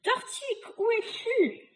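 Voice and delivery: high calling-out voice; hailing. Acted. Witch to the unseen tortique, high searching call. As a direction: hail someone you can't see. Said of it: "Elle héla le tortique également".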